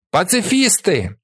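high, drawn-out insult; joining the jeers. A narrator's reading. Third boy joining in the abuse, high drawn-out cry. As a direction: call it out slowly and high. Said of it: "приєднується третій".

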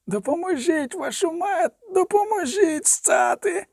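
very high, strained pleading wail; desperate cry for help. A narrator's reading. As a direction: beg for help, voice cracking up high. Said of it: "дерся Оль Гарзгайм".

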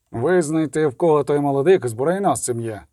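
ordinary delivery

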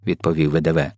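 plain reading voice

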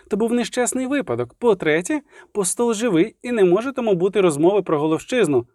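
high-pitched bard making his case; argumentative bard. A narrator's reading. Dandelion backing Milva against the villagers, argumentative. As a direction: argue point by point in a high voice. Voices Dandelion arguing back.

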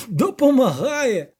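high, loud, drawn-out incredulity; mock the idea in a loud high voice; guffawing disbelief. A narrator's reading. Poet scoffing at the helpful devil, loud amused disbelief. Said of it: "зареготав Любисток".

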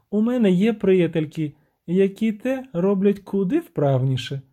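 high-voiced siren, reproachful; indignant siren. Siren scolds her lover for sacrificing nothing, high reproach. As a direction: complain in a high, lilting voice.

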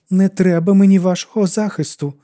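rising panic, trying to sound firm; fear in her voice. A narrator's reading. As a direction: push back, frightened. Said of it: "Лора чула у власному голосі страх".

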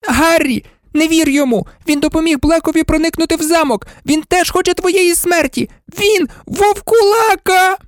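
very high, frantic warning; frantic high-pitched girl; screeching. A narrator's reading. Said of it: "заверещала Герміона".

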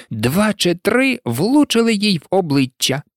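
ordinary delivery, nothing marked

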